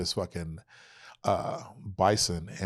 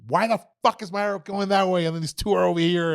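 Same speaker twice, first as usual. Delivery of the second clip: frustrated yell; 9 semitones higher, 5 dB louder than usual. Retold or imitated. Frustrated at arrow flight.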